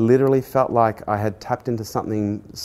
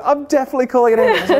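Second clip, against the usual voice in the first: much higher, louder, fast, laughing; laughing mock panic; 6 dB louder, faster than usual. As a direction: squeal it out half-laughing as the panicked assistant. PA quoted, speaker laughing.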